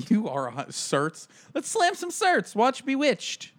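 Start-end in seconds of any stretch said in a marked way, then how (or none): 1.5-3.5 mock old-timey pitch. Mock old-timey invitation, laughing.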